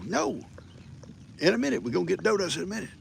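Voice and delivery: usual speaking voice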